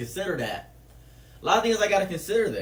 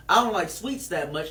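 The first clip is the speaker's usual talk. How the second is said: ordinary talk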